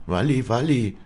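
high, loud cry of the name; calling out. A narrator's reading. Afra hearing Wally means to leave, calling out. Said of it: "rief Afra".

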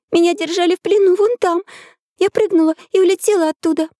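put-on high, childlike girl's voice; little girl voice. A narrator's reading. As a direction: speak like an eager young girl.

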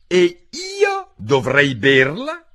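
high, loud, drawn-out scorn; slow, high-pitched scornful bird; deep contempt. A narrator's reading. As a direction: stretch it out in disbelief. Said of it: "in tono di profondo disprezzo".

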